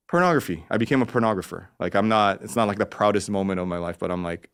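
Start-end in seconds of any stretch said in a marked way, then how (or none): none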